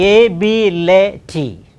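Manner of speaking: loud, separated syllables "A, B, L, A, T"; alternative syllable split. Shown as a demonstration. Teacher questioning the syllable division, "A, B, L, A, T" in separate beats. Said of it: "why can't it be".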